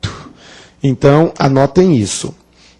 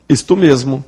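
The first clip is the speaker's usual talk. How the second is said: normal talking voice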